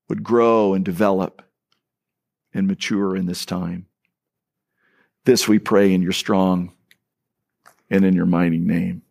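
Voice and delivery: low, unhurried prayer voice; pastor's prayer. Acted. Pastor prays over the church before the sermon, reverent and low. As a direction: pray it reverently, phrase by phrase.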